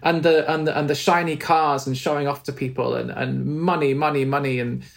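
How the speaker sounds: ordinary delivery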